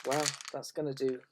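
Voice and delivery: ordinary talk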